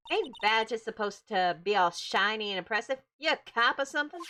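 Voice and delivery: suspicious Southern local; Southern accent. Acted. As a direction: question them in a put-on Southern accent. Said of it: "get my best southern accent on".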